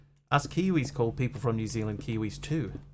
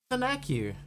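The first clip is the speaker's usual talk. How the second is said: silly voice; 11 semitones higher than usual. Acted. Thanking a chat viewer.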